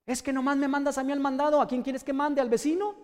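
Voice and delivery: put-on high, protesting teenager talking back; rebellious teen. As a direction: complain like a put-upon kid, voice climbing. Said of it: "está la rebeldía".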